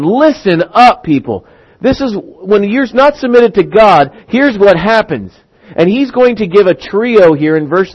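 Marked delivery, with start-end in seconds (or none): 0.0-5.3 higher, stretched-out opening call; calling to attention. Acted. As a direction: stand up and address the crowd as James. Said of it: "James is kind of standing up going".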